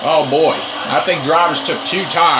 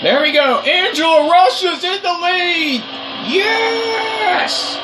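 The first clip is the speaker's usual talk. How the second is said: high, joyful yell; triumphant shout; 12 semitones higher, slower than usual. Real, not acted. Teammate takes the lead.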